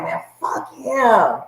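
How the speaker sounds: drawn-out, slow muttered curse; muttered dismissal. Retold or imitated. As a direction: drag it out slowly under your breath. Her own reaction, quoted.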